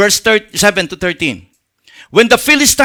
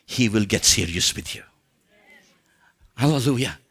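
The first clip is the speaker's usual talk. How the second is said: much lower and softer, a confiding sermon voice; hushed intimate preaching; 13 semitones lower, 10 dB quieter than usual. Acted. Pastor confiding God's closeness to listeners, hushed. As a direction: draw the congregation close with a low murmur.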